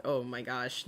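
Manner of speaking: usual speaking voice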